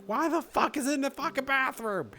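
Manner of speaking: high-pitched exasperated squawk; hammed-up disbelief at a prankster; mock outrage. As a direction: pretend to lose it, voice shooting way up. Acting out exasperation at the ghost.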